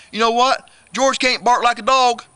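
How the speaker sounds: plain reading voice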